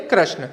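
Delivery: "कृष्ण" as krashna, with ra; a falling krashna for "कृष्ण", a in place of i; common mispronunciation. Shown as a demonstration. Teacher repeating the wrong form for pupils, "कृष्ण" as krashna. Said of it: "क्या बोलते थे?".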